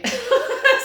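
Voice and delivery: short high-pitched burst of laughter. Real, not acted. Recounting a costly errand to viewers, high laugh. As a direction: laugh out loud, high and brief. Amused at the lawyer's advice.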